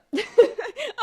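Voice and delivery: high burst of laughter. Real, not acted. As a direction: laugh out loud, rising. Reacting to a clip.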